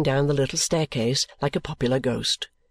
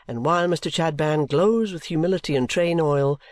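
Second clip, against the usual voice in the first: ordinary delivery, nothing marked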